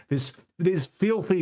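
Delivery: normal talking voice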